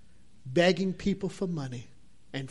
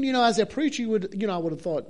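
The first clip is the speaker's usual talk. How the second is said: ordinary talk, nothing marked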